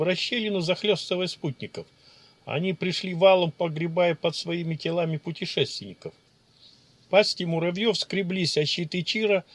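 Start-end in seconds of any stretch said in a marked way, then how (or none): none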